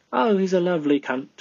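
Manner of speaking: playful mock British accent. Shown as a demonstration. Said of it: "That's my British accent".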